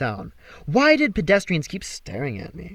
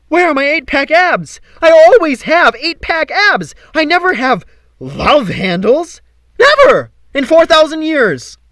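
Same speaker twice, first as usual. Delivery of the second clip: frantic rant; 13 semitones higher, 12 dB louder than usual. Acted. Apollo ranting about lost abs.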